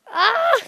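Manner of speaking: high, loud hammed-up shriek; mock terrified scream. Gamer fleeing the evil Mario balls, hammed-up scream. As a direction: scream like a horror victim.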